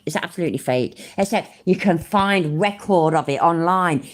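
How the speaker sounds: ordinary talk, nothing marked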